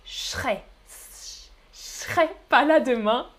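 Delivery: je serai shown as ch'rai; contracted form. Shown as a demonstration. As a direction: draw out the hushing sound of ch'rai in je serai. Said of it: "j'ai prononcé". (« je serai » prononcé ch'rai)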